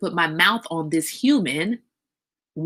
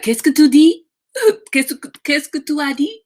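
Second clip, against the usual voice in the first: higher, louder, laughing French; baby asking in French what you said, higher and laughing; 8 semitones higher, 7 dB louder than usual. Retold or imitated. Imagined baby son baffled by baby talk, laughing French. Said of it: "He probably would have been like".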